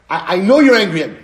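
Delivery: loud, fast accusing quote; mock accuser. Acting a friend who assumes anger, loud and quick. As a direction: blurt out the accusation.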